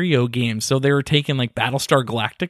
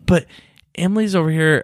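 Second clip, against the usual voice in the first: usual speaking voice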